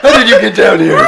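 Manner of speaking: high, loud cartoon voice cracking up; laughing cartoon shout. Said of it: "He's like".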